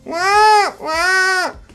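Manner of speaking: put-on very high whining eh eh like a crying child; fox cry imitation. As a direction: whimper like a fox at dawn. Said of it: "l'imitazione della volpe". (verso della volpe acutissimo)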